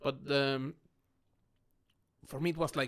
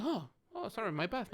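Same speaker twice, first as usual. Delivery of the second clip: higher-pitched, backpedalling apology; retold sheepish apology; 7 semitones higher than usual. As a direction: back off quickly in a raised voice. Retold apology after causing offence.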